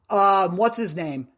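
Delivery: ordinary talk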